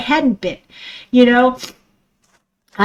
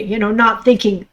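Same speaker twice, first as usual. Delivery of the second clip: normal talking voice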